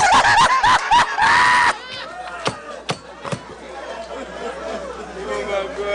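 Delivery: long shrieking laugh that tails off; uproarious laugh. Real, not acted. Host cracking up at the comedian's joke, uproarious.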